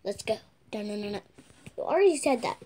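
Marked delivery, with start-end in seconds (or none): none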